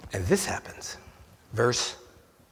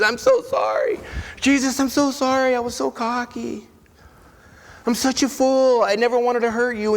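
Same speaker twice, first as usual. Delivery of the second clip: higher, louder, pleading and overwhelmed; overwhelmed apology; 8 semitones higher, 3 dB louder than usual. Acted. Peter running to Jesus, blurting an overwhelmed apology. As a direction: blurt the apology out through rising emotion.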